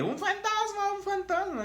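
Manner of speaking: very high squealing mock scream; shrill mocking "¡Un fantasma, un fantasma!" squeal; mocking girlish scream. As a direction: ham up the fishermen screaming like girls. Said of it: "gritando como niñas".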